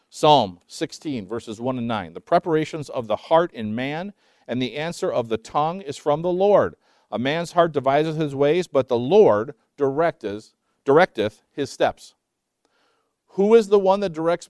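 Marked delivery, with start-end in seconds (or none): 2.1-12.1 scripture reading. Acted. Reads scripture aloud.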